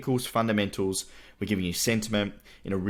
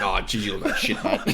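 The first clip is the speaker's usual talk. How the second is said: imitating dad. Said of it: "He's like".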